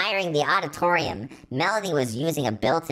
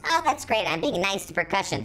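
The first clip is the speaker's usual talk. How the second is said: usual speaking voice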